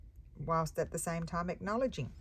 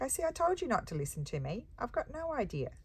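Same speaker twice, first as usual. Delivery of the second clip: sheepish; 9 semitones higher than usual. Retold or imitated. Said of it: "I'm like".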